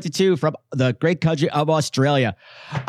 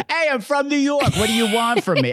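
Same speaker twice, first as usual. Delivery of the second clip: higher, hammed-up New York accent; 5 semitones higher than usual. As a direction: play the brash New Yorker, accent and all. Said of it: "we'll go back to our regional accents".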